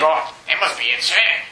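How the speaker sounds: lightly voiced gossip, pitched well down; low, knowing aside of a busybody; judging onlooker. Preacher mimicking someone judging another's troubles, lightly voiced judging onlooker.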